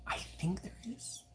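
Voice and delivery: soft unvoiced whisper. Real, not acted. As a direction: whisper it to yourself. Whispered aside to self.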